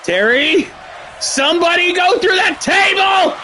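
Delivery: high shout, punching that in somebody go through that table; thrilled fan yelling at the screen; excited pleading shout. Real, not acted. Begging for a table spot.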